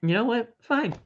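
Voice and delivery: high, grudging give-in; lightly hammed reluctant friend; mock grudging Zayn. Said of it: "he's like".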